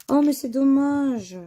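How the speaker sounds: sharp "oh" of disappointment; a quick, bright cry of regret. Real, not acted. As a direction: exclaim the letdown, up and out. Disappointed at missing letter.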